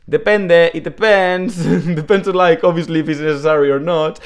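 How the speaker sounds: high, light shrugging maybe voice; hedging shrug. Pretend. Teacher playing someone who won't commit, high and shrugging. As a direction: toss it off like a noncommittal who-knows. Voicing a noncommittal hedging answer.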